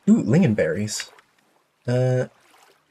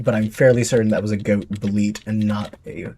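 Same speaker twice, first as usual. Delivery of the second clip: normal talking voice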